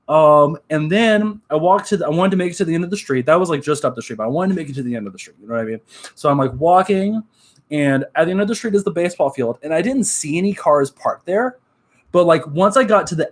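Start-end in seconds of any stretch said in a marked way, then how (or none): none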